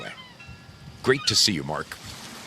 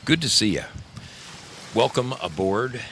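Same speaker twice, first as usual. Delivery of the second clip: usual speaking voice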